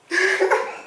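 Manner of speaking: high burst of laughter. Real, not acted. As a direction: crack up at your own story. Laughing at her own prank story.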